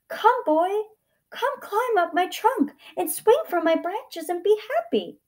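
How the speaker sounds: high, excited invitation; joyful. A narrator's reading. Tree welcoming the boy back, overjoyed. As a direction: call out high with delight. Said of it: "the tree shook with joy".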